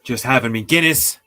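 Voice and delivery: ordinary delivery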